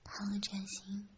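ordinary delivery

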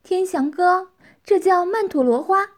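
high, loud, eager telling; urgent. A narrator's reading. Tianqi naming the flower for Tianxiang, urgent. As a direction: tell him urgently, pitched right up. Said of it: "急切地说道".

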